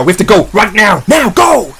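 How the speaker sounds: rising into a high shout; urgent shout. Acted. Man urging escape from the T-Rex, urgent shout. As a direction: build to a yelled command.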